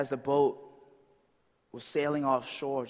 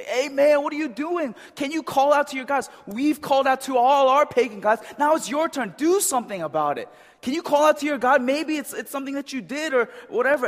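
higher, louder urgent shouting; frantic sailors; 8 semitones higher, 5 dB louder than usual. Retold or imitated. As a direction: yell at him over the storm. Sailors yell at Jonah in storm.

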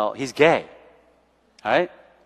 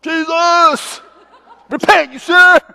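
much higher, loud mock preaching with a laugh; hammed-up hellfire preacher; mock street preacher; 7 dB louder, slower than usual. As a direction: parody a pushy street evangelist. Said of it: "we weren't like".

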